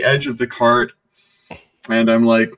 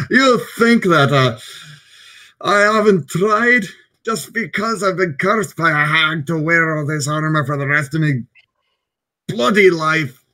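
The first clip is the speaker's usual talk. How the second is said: higher-pitched, aggrieved dwarf outburst; blubbering dwarf; 6 semitones higher than usual. Acted. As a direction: protest in blustering self-pity. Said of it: "still blubbering".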